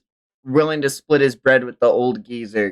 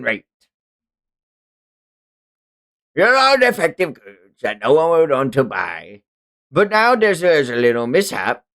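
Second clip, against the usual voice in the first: sneering pirate captain, raised pitch; slaver captain; 4 semitones higher than usual. Acted. Streamer reading manga as the slaver captain, gloating.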